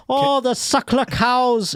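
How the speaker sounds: high, slow mock Irish brogue; attempted Irish accent. Shown as a demonstration. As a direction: lilt it high like an Irishman, drawn out. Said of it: "Is that Irish?".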